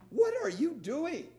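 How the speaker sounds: high, loud, outraged question; indignant. Retold or imitated. Peter objecting to Jesus, high and indignant. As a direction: Peter can't believe it. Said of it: "With a little tone of indignation".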